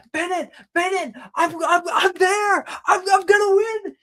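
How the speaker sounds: high, loud, frantic shout; excited yelling. Acted. Racer yells to her coach that she's winning, high excited yelling. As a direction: yell breathlessly keen to be heard. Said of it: "She's yelling at you".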